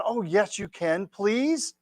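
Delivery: mock begging with a drawn-out please; pleading. Young man begging his date for a kiss, playfully pleading.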